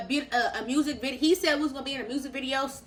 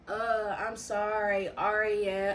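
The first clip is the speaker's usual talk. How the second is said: mock moaning; 5 semitones lower, 6 dB quieter, much slower than usual. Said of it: "He was moaning my name".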